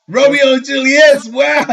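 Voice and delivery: high amazed exclamation. Real, not acted. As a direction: sound impressed and amazed. Amazed at the distance.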